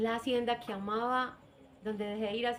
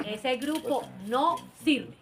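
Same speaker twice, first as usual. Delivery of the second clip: slow firm verdict; 3 dB louder, much slower than usual. Retold or imitated. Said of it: "yo dije".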